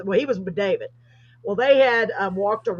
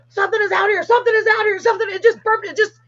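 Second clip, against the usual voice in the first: much higher and louder, panicked; terrified friend; 13 semitones higher, 6 dB louder, faster than usual. Retold or imitated. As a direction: blurt it out like you just heard a monster. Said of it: "Brett's scared to death".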